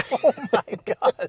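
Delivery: high, loud "oh my gosh" through laughter; real laughing shock. Real, not acted. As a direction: gasp it out, astonished and tickled. Laughing shock as seat moves.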